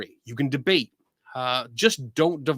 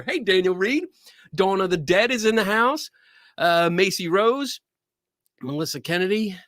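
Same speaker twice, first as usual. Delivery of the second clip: ordinary talk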